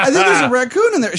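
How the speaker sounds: high, loud mock panic through laughter; hammed-up alarmed squeal; frantic. Girlfriend reporting a raccoon in the vent, frantic.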